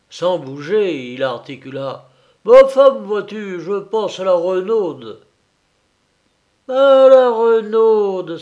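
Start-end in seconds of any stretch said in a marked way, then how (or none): 2.4-5.2 raised, loud peasant husband's voice; farmer's weighty announcement. Acted. Farmer announces his idea to wife. 6.7-8.4 loud, high, drawn-out exclamation; crying out. A narrator's reading. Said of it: "s'écria la fermière".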